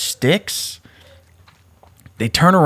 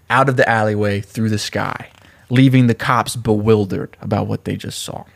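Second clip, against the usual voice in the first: ordinary delivery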